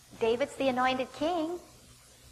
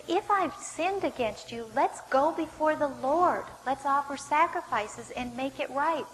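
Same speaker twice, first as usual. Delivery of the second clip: higher, slow, emphatic appeal; pleading; 4 semitones higher, slower than usual. Acted. David offers Saul to go before the Lord, pleading. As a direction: urge it slowly and earnestly. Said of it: "He tries to plead his case again".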